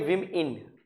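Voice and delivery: विम इन as two short beats; slow विम इन with short "i" sounds; syllable by syllable. Shown as a demonstration. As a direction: separate विम from इन. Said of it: "Sounds like".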